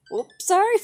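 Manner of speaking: high, loud squeaky oops; startled apology. Real, not acted. Streamer to their cat, high loud apology. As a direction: blurt a sorry high and loud.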